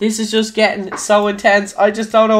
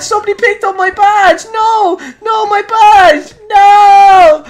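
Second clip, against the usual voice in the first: high, loud yells of triumph then panic; ecstatic shouting; 10 semitones higher, 5 dB louder than usual. Real, not acted. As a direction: scream it as the win turns to loss. Wins, then loses his badge.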